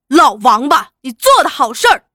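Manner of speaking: loud, fast accusing yell; furious shout. Acted. Son shouting at his father while slapping him. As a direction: roar the insult as you strike. Laoliu slapping the old man.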